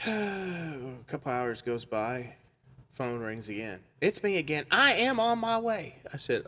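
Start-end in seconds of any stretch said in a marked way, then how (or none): 4.0-6.0 insistent repeat caller. Retold or imitated. Caller rings the funeral worker back, loud and insistent. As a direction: mimic a caller loudly stressing each word.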